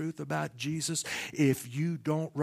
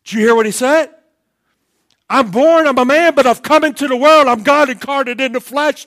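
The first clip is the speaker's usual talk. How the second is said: full-force preaching; 11 semitones higher, 22 dB louder than usual. Acted. Preacher proclaiming Jesus' kingship to the church, full-force preaching. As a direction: thunder the verse out to the congregation.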